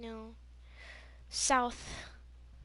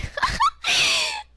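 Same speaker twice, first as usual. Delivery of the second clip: loud, very high burst of giggles; giddy squeal of laughter; shrieking laugh; 12 dB louder than usual. Real, not acted. Giggling about sitting by cute boy.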